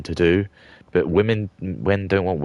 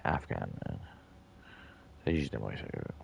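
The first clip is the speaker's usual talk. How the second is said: ordinary talk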